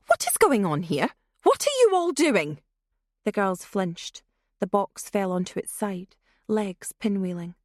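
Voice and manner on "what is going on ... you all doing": louder, rising sharply higher in shock; mother bursting in, appalled; a shocked mother demanding answers; aghast demand. Acted. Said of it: "but aghast all the same".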